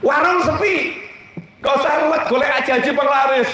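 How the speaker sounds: full-force sermon building to a shout; fiery preaching. Acted. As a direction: drive the point home to the congregation.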